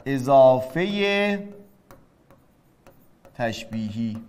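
drawn out word by word for note-taking; slow dictation. Shown as a demonstration. (آهسته و کشیده برای یادداشت)

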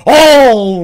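high, slow shocked shriek; shrieking in sudden fright; startled scream. Real, not acted. Startled by attacker in game.

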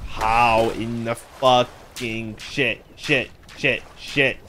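loud, drawn-out disbelief, each word separated; exasperated swearing. Real, not acted. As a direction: stretch the curse out, then punch each repeat. Swearing at a sudden mishap.

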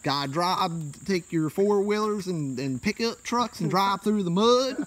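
slow, high country drawl; hammed-up Appalachian hillbilly; hillbilly accent. Joins the hillbilly bit.

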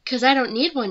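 defiant retort. Acted. Mal shutting down her friend's teasing, loud defiant snap. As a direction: fire it back, proud and loud.

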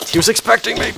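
high, sudden startled cry; startled squeal. Real, not acted. Gamer startled by a creeper ambush, yelps high. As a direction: yelp it as the creeper appears.

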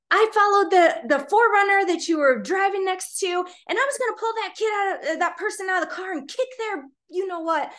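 high, heated retold rant; so upset. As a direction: imitate an irate neighbour threatening what he nearly did. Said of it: "'cause he was so upset".

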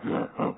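low throaty brute grunt; mock ogre noises. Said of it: "these big ogres".

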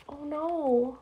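usual speaking voice

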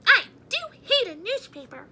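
high loud grumpy mouse. A narrator's reading. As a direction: grumble it as a cross mouse. Mouse character voice.

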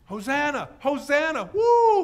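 high, rising shouts into a whoop; crowd shouting. Acted. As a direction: cheer like a jubilant crowd. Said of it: "the people were shouting".

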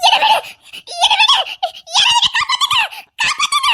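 very high, desperate screams; screaming for help. Acted. Girl grabbed by the man screams desperately for help. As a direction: scream to be let go and rescued.

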